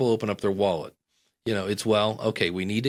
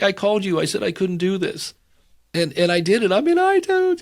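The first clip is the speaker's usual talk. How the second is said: high, loud, squealing disbelief; ecstatic imitated squeal; 8 semitones higher, 6 dB louder than usual. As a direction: squeal it like you can't believe you did it. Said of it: "she called me the next day crying".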